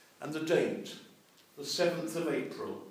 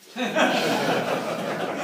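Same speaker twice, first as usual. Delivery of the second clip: laughs; 7 semitones higher, 6 dB louder than usual. Real, not acted. Preacher laughing at his own joke to the congregation. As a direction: burst of real laughter, loud.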